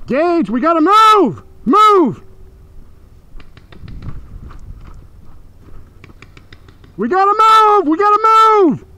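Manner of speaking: loud urgent yell to move; urgent shouting. Real, not acted. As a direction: shout at your teammate to move. Urgent callout in paintball game.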